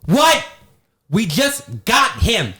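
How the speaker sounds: high-pitched imitation of a moviegoer yelling at the screen; mock outraged cry, second part drawn out; retold indignant yell. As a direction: yell "what" high, then drag out the protest. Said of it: "She literally yells to the screen".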